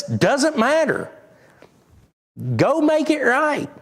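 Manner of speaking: high, firm command; firm divine command; voice of God. Retold or imitated. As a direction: tell them plainly to go fix it. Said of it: "God says".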